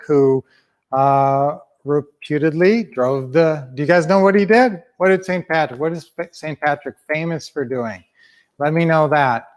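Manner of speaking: ordinary talk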